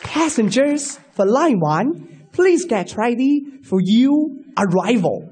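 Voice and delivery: slow announcer English; slow, even transit announcement; English station announcement. Retold or imitated.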